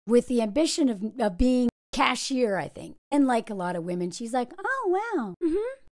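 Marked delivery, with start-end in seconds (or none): 4.6-5.8 high breathy-free swoon, mocking her awe; mock impressed woman. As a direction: play a starry-eyed woman, hammed-up. Mimicking April being impressed.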